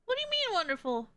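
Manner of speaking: quick, high-pitched retort; indignant disbelief. Real, not acted. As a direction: throw it back in protest. Indignant reaction to game line.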